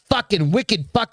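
mock East Coast, Boston talk; attempted Boston accent. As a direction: have a rough go at a Boston accent. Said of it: "or Boston accent".

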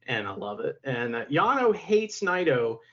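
normal talking voice

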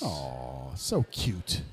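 soft, low coo at something cute. Real, not acted. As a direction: melt over a cute picture. Cooing at cute Pokemon picture.

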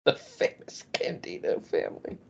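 hushed, slow whispered echo. Shown as a demonstration. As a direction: whisper it back slowly. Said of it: "repeat in a whisper".

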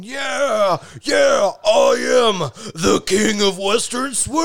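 slow, booming self-crowned king; megalomaniac rant. Retold or imitated. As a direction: gloat like a triumphant bandleader king. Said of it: "your vocalization was actually pretty, uh, pretty on point".

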